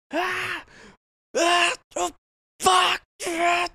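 high, loud screams of agony; hammed-up shrieking in pain; imitated screaming. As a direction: scream like the patient next door. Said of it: "screaming out of his fucking lungs".

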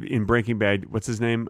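usual speaking voice

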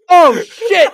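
very high, very loud laughing "Oh, shit"; mom's shocked cry. Retold or imitated. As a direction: shriek it through laughter like the mom. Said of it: "She goes".

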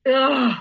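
fed-up "ugh"; exasperated groan. Real, not acted. Recalling the cycle of love bombing, exasperated groan.

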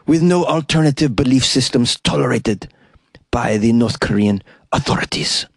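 put-on Spanish-accented reading, sultry and smooth; Antonio Banderas impression. Host reads UN chief's quote as Antonio Banderas, in a Spanish accent. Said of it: "I'm gonna do an Antonio Banderas impression".

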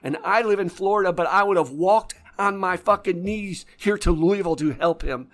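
loud, high, distraught outcry; a retold grieving sister, loud and high; tearful near-scream. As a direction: scream it through grief like the sister. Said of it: "almost screamed in tears".

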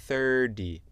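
American thirty, loud, like thurdee; American D sound. Shown as a demonstration. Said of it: "Je dis avec le son D".

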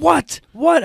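high, loud screamed what; screaming what. Retold or imitated. Coach screaming at the referee over the pin call, high and loud. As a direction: scream it at the ref in disbelief. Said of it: "I was screaming".

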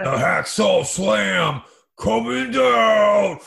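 slow, loud ring announcer call; wrestling announcer. Acted. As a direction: hype up the big slam.

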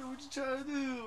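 teasing a tweaker, mocking his challenge. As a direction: throw the question back, taunting. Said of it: "we're just like".

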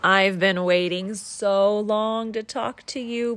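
ordinary delivery

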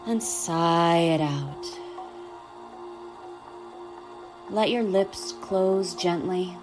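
calm, unhurried guiding voice; guided meditation. Acted.

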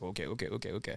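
very soft, low nervous repetition; tense self-talk. Retold or imitated. Actor waiting at the curtain, tense low muttering. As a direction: psych yourself up under your breath. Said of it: "getting all intense with it".

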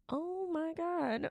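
slow, drawn-out, high "Oh my God"; mock horror. Sobering up and seeing his face, a slow horrified gasp. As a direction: let it dawn slowly, horrified. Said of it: "I'm like".

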